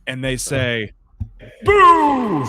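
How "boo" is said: loud high playful boo; ghost boo. Pretend. Playful scare call.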